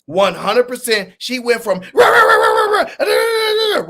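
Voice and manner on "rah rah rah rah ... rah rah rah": high, loud squawked rah-rah noises; mock loud yapping. Host imitates opponent running her mouth, shrill mock yapping. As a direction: imitate someone running their mouth nonstop. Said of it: "running her mouth at a hundred miles per hour".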